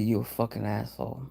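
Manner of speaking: low, quiet muttered insult; under the breath, to yourself; quiet inner voice. Retold or imitated. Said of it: "in my head, I'd be".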